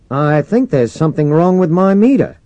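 loud, high-pitched radio reply. A narrator's reading. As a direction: project it back over the radio. Commander radios back to crewman.

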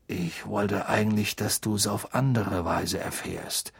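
low, soft, worried man; worried father figure. A narrator's reading. Mister Weasley regrets how Harry found out, low worried voice. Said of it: "sah jetzt besorgt aus".

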